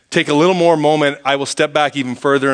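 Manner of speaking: usual speaking voice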